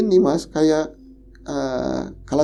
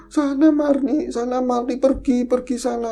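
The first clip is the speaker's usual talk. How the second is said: frightened pleading; 8 semitones higher than usual. Retold or imitated. Begging ghost to go away.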